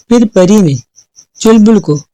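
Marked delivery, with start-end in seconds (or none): none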